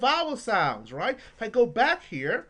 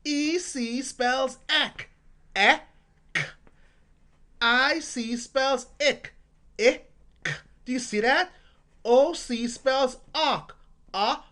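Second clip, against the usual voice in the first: slow drill, each ending sound punched; teacher exaggerating syllables for parents; emphasized sounds; 3 semitones higher, 3 dB louder than usual. Shown as a demonstration. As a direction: stress every ending like a chant. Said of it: "I'm just emphasizing the sounds".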